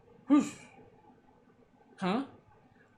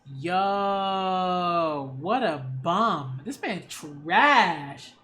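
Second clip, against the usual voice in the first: disgusted verdict; much slower than usual. Real, not acted.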